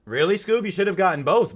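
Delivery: surprised. Real, not acted. Surprised at friend's video.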